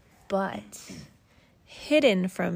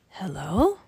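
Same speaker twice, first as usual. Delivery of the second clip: plain reading voice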